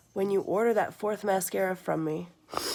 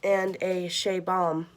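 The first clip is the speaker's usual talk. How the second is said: slow and careful; 3 dB louder, slower than usual. Real, not acted. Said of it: "I have to take my time saying that".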